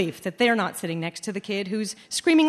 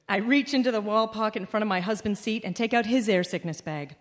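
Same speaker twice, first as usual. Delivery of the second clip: ordinary talk, nothing marked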